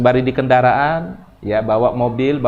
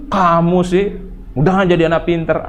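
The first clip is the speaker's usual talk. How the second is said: raised, playful parental nagging; mock scolding; 5 semitones higher than usual. Shown as a demonstration. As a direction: scold the child with a blessing, higher-pitched. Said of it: "kalau ngomelin itu yang bagus-bagus deh".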